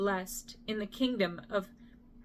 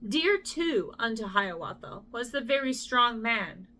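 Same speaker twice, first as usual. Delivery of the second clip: ordinary delivery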